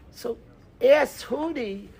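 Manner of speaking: ordinary delivery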